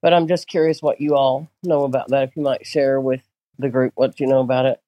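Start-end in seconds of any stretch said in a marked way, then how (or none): none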